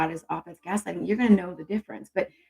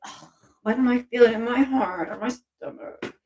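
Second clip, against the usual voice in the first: frustrated seeker; 4 semitones higher than usual. Retold or imitated. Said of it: "'Cause that's a very different vibe".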